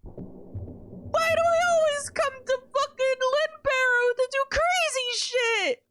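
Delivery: high, loud, slow incredulous shouting; disbelieving yell. Real, not acted. Player reacting to the game's wild twist, shouting in disbelief. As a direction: holler it half laughing, can't believe it.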